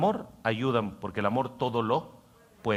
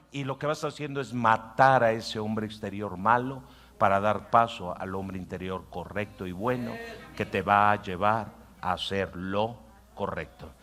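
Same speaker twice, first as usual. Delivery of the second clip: ordinary delivery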